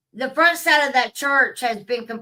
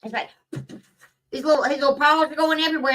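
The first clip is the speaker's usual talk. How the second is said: ordinary talk, nothing marked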